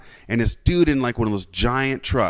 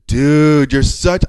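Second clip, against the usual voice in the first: shocked; 3 semitones higher, 7 dB louder, much slower than usual. Retold or imitated. Telling off the rude driver, shocked. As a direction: reenact calling someone out in shock. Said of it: "I was so shocked that I just went".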